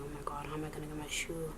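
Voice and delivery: low-key, hushed muttering to yourself; soft, rapid acted thinking aloud; worried inner thoughts. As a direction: fret under your breath, soft and quick. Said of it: "I'm thinking, I'm like".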